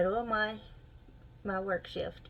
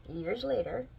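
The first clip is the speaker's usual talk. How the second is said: ordinary talk, nothing marked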